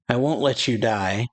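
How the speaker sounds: normal talking voice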